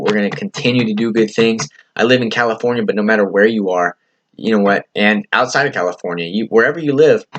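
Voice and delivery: usual speaking voice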